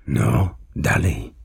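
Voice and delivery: usual speaking voice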